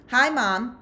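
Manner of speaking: mocking greeting.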